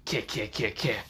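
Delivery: low, soft staccato cackle; hushed little creepy-doll cackle; creature cackle. Acted. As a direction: cackle quietly in short separate syllables. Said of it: "the doll right now cackles".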